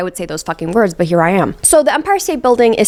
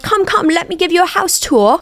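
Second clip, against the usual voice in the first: much higher, breezy host voice; posh host Felix; 9 semitones higher than usual. Retold or imitated. Felix welcoming Oliver into the castle, breezy high voice. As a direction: sweep him in like a lord of the manor. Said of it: "Felix is like".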